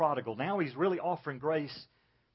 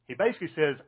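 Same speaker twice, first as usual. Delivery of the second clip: ordinary talk, nothing marked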